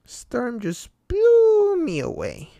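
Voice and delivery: high, loud, slow clown drawl; Pennywise. A narrator's reading. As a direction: stretch it out, grinning and eerie. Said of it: "Pennywise the dancing cloud said".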